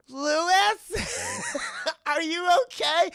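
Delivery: high, slow drawn-out hammed-up call; mock anxious calling. Anna calls to zombie husband from bathroom, mock anxious. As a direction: call out pitifully, loud and high. Wife calls timidly through door.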